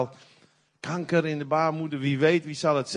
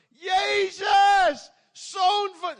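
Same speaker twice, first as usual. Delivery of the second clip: much higher, loud desperate shout; crying out to Jesus; 14 semitones higher, 3 dB louder, much slower than usual. Retold or imitated. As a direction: call out over the crowd to Jesus. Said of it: "begon hij te roepen". (blinde Bartimeüs roept luid naar Jezus)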